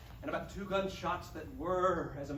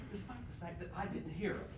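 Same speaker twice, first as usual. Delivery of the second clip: quick aside; 3 semitones lower, 7 dB quieter, much faster than usual. Acted.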